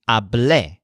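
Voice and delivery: hablé with the final syllable, blé, stressed; stress on last syllable. Shown as a demonstration. Teacher giving Joel's past-tense word, final stress on hablé. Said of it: "with the stressed syllable le".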